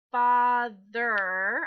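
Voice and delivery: "father" drawn out slowly and loud; sounded out. Shown as a demonstration. Teacher reading through the whole word, "father" stretched out. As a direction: stretch both syllables of "father". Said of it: "look through the whole word".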